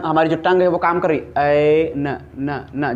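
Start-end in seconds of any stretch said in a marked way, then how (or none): 1.6-2.9 ए then न न न, very slowly, tongue tapping; ए न न न pulled out at a crawl; stretched sound demo. Shown as a demonstration. Teacher showing students the tongue tapping on न. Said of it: "जब हम ना बोले तो हमारी जो टंग ऊपर टच हो रही है".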